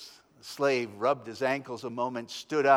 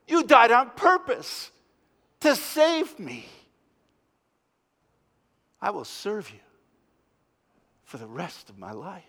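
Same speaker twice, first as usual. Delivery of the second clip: tender devotion; 4 semitones higher than usual. Pretend. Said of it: "look at the cross and say".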